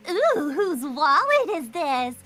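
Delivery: put-on high, drawn-out teasing cat girl; loud, nosy sing-out of a little troublemaker; Nekomata. Cat girl snooping through someone's stuff, high and teasing. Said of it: "Nekomata is this little cat girl".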